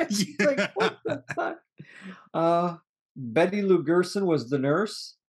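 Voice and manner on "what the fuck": high, laughing retort; retold shock, high and giggly; laughing mock disbelief. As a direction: squeal it through a laugh. Retold reaction while laughing.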